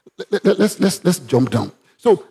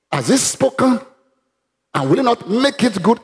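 preacher proclaiming the oracle; oracle proclamation; 3 semitones higher than usual. Acted. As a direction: declare it as prophecy. Oracle read high.

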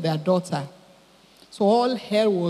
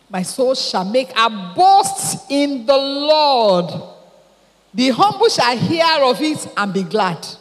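proclaimed scripture; 4 semitones higher, 5 dB louder, slower than usual. Acted. Reading Psalm aloud.

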